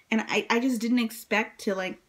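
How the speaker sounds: usual speaking voice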